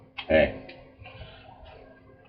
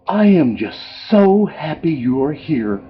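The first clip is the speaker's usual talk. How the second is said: higher, sugary chapel lady imitation; overly bubbly lady; 5 semitones higher than usual. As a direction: gush like a cartoonishly cheery visitor. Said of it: "so bubbly and cheery, it wasn't even real".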